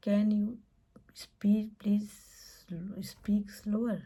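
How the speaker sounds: each word spaced out and whispery; teacher modelling the request slowly for learners; slow model phrase. Shown as a demonstration. Teacher modelling a slow, whispered request. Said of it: "Can you please speak slower?".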